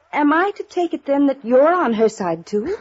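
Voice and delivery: indignant challenge. Acted. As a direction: demand it, offended and sharp. Fiancée confronts detective, rising.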